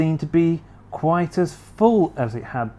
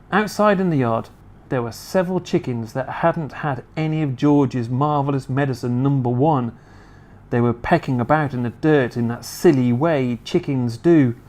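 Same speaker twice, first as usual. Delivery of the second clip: plain reading voice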